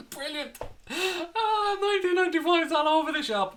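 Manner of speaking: very high, tearful-with-laughter squeal; laughing delight. Real, not acted. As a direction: squeal it through real laughter. Delighted as chat fills with 1995.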